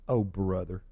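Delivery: very low, barely voiced sigh of a phrase; weary oh brother. Real, not acted. Preacher fed up with Jonah, low mumbled scorn. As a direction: roll your eyes and mutter it. Weary aside after quote.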